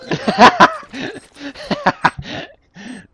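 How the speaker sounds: high, loud cackling laugh; loud burst of laughter. Real, not acted. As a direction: explode into laughter.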